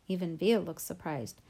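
ordinary delivery, nothing marked

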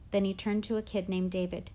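ordinary delivery

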